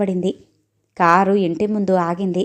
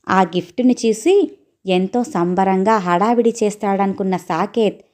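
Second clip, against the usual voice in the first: ordinary delivery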